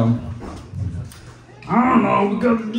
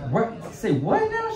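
high-pitched, baffled reaction; incredulous quote; 4 semitones higher, 3 dB quieter than usual. Retold or imitated. Comic retelling of being told something odd, incredulous. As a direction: squeak out mock disbelief. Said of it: "I was just like".

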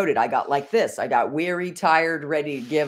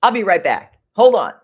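1 loud call; 4 semitones higher, 9 dB louder than usual. Real, not acted. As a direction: hold on called out loudly. Calling out again.

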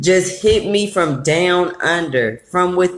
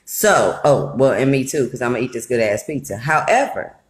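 normal talking voice

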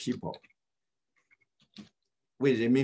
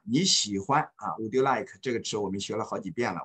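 usual speaking voice

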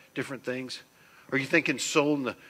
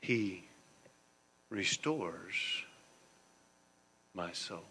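softly, dropping away to a hush on my soul; a quiet, reverent reading of the verse; hushed, slow reading; 4 semitones lower, 7 dB quieter, much slower than usual. Shown as a demonstration. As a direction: read it soft and slow, letting it fade. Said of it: "Last part of the sentence".

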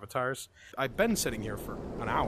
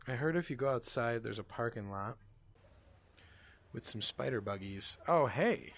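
usual speaking voice

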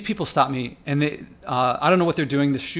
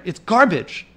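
louder, heated outburst; worked up; 3 semitones higher, 6 dB louder, slower than usual. Real, not acted. Walking past litter, fed up with the trash, heated. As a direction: spit out the verdict in real anger. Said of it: "Sorry if I'm getting, getting worked up here".

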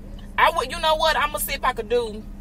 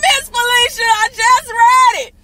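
high, loud joyful shouting, slowing down at the end; excited shout; 10 semitones higher, 7 dB louder, slower than usual. Real, not acted. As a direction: shout for joy at the good news. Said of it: "I'm sorry, I got loud".

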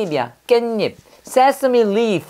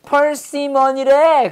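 answering voice; 6 semitones higher than usual. Retold or imitated. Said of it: "그랬더니".

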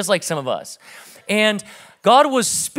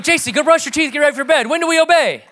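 louder, much higher call to his daughter; calling dad; 8 semitones higher, 5 dB louder, faster than usual. Retold or imitated. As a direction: call down the hall like a cheerful father.

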